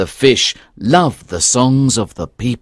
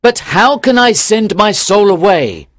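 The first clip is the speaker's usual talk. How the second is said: louder, excited fisherman voice; joyful cry; 3 semitones higher, 6 dB louder than usual. Acted. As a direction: call out to her in joy. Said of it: "cried the young fisherman".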